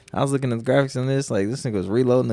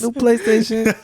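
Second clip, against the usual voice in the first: high, loud gloating cheer; gleeful gloat; 12 semitones higher, 5 dB louder than usual. Pretend. Friend mimicking his joy over the console, loud high gloat. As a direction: crow about it.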